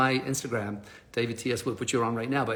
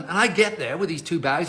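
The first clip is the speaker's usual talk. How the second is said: ordinary talk, nothing marked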